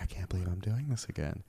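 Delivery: inner thought. Retold or imitated. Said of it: "in my mind".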